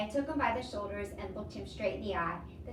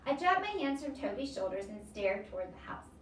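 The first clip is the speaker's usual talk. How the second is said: usual speaking voice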